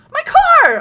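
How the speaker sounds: excited shout. Real, not acted. Finds car.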